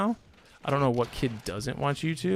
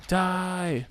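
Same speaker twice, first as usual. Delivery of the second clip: game taunt; 6 semitones higher than usual. Real, not acted. Gaming, attacking opponent.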